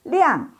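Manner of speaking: Liang said high with a falling tone; tone demo. Shown as a demonstration. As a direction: model Liang for students. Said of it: "Terang itu liang".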